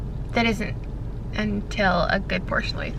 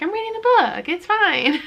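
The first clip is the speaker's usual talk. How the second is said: upbeat; 8 semitones higher than usual. Retold or imitated. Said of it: "I usually start out with being like".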